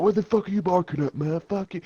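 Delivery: high-pitched mock outrage; retold angry challenge, pitched well up; mock drunken confrontation. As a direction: play an aggressive drunk, high-voiced. Drunk man confronts the dad.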